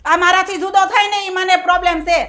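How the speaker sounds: loud, heated complaint; mother-in-law shouting at her daughter-in-law; angry shouting. Acted. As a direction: shout your hurt at her. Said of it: "અત્યારે જ તમે કેટલી બૂમો પાડો છો".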